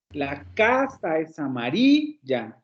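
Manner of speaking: "casa" and "amarilla" stressed, no break after "casa", slow; smooth run, stress on casa and amarilla. Shown as a demonstration. Teacher models the sentence slowly for a student, stress on casa and amarilla. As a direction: say "la casa es amarilla" slowly in one flowing phrase. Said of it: "Cuando lo dices de manera corrida".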